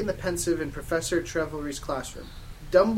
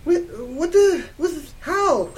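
high, loud, stammering disbelief; slow, sputtering mock scream; 12 semitones higher, 8 dB louder, slower than usual. Girl forgetting she addresses a teacher, screamed. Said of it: "I screamed".

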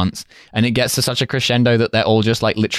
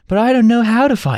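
high-pitched mock protest; helpless film character; 13 semitones higher than usual. Newcomer protesting to the hooligan, mock helpless. Imitating Matt, afraid to fight.

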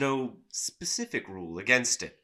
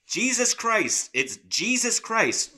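retold shout; 10 semitones higher, 6 dB louder, slower than usual. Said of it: "a guy last week who shouted".

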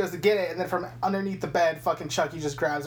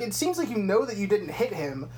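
ordinary talk, nothing marked

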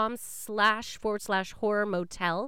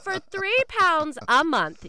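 salesy pitch; 9 semitones higher, 7 dB louder, slower than usual. Acted.

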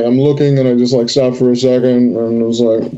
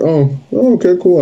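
casual feigned nonchalance; 7 semitones higher than usual. Acted. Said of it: "do that thing where you're like".

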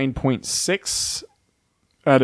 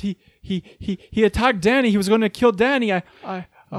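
light mock stutter, higher pitch; stammering Jon; 7 semitones higher than usual. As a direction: stammer nervously as flustered Jon. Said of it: "Jon would be like".

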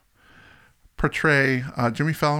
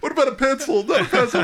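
much higher playful quiz voice; imitating the sketch; 12 semitones higher, 4 dB louder, faster than usual. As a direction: lightly mimic the sketch host. Quoting the comedy sketch, amused.

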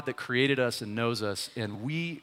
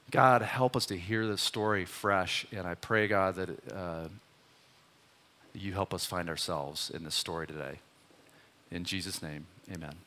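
softer, lower prayer voice; quiet prayer; 7 semitones lower, 5 dB quieter than usual. Real, not acted. Preacher praying over the congregation after the story. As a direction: pray gently and inwardly.

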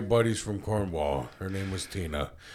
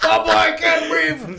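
put-on gasping kid; 7 dB louder than usual. Kid gasping from spicy food.

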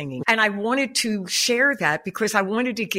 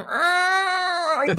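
groan; 11 semitones higher than usual. Retold or imitated. Retelling the sixteen-mile struggle.

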